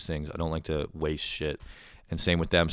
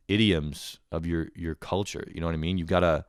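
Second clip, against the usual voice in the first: normal talking voice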